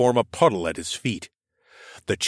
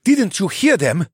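higher, louder, indignant retort; angry prisoner; 11 semitones higher, 6 dB louder than usual. A narrator's reading. The cheetah snaps at his captor, high and loud. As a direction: throw the question back in disbelief.

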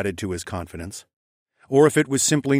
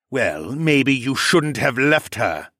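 slow, louder sneering taunt; 3 semitones higher, 4 dB louder, slower than usual. Acted. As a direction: drag out the taunt with contempt. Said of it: "he sneered".

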